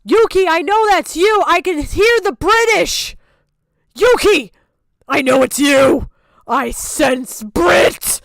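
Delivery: loud accusing yell; excited shout. Real, not acted. Shouting at a disguised friend to unmask her. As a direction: call her out loudly.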